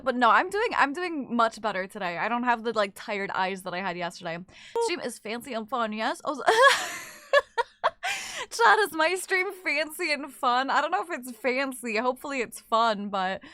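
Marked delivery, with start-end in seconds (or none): 6.5-7.1 high laugh. Real, not acted. Laughs at chat question.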